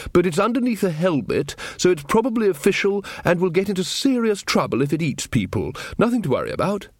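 a hint of a hearty old wizard brushing it off; Ridcully voice. A narrator's reading.

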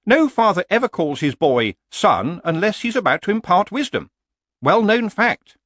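high horse voice, explaining; know-all horse. Acted. Horse explains why fathers say son, high and knowing. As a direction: lecture him, pitched well up.

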